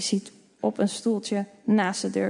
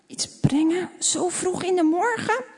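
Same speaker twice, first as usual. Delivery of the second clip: put-on surprised old woman; 8 semitones higher than usual. A narrator's reading. Old neighbour questions children.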